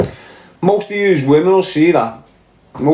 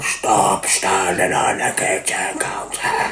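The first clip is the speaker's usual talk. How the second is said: slow, low, boomed warning like through a mic; megaphone voice; 8 semitones lower, 5 dB quieter, slower than usual. Shown as a demonstration. Parent booming at kids on the counter like a megaphone. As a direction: boom it out slowly as if on a loudspeaker. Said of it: "like a big microphone".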